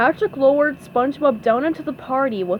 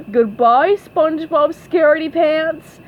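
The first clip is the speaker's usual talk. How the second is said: loud, raised taunt; Squidward yelling; 7 semitones higher, 7 dB louder than usual. A narrator's reading. Squidward jeers as SpongeBob goes home, loud mocking yell. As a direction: call it out mockingly. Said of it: "yelled Squidward".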